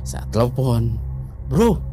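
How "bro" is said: retold indignant demand. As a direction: confront your friend, acting it out. Acted angry phone call to friend. (menirukan teman yang mengomel keras)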